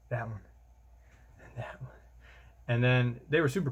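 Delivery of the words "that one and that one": whispered, fading softer; whispering. Retold or imitated. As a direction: point them out in a hush. Officer quietly pointing them out.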